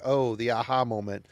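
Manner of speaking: ordinary delivery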